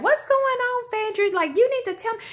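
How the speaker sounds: high, clueless airhead voice; airhead Portia. Retold or imitated. Portia asking Phaedra what's happening, high clueless airhead voice. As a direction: play her as a lost, bubbly airhead. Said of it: "with her airbrain self".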